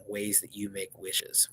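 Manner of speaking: plain reading voice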